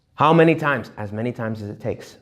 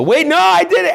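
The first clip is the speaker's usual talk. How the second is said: high, loud panicked cry; panicked protest; 7 dB louder than usual. Retold or imitated. Person praying, hit by distractions, cries out in panic. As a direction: yelp it like the win just slipped away. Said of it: "and they go".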